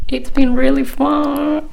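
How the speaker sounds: dry, slowed sarcasm with a laugh behind it; sarcastic. Real, not acted. Vlogger joking to viewers about a third lockdown, sarcastic. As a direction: say it like lockdown is anything but fun.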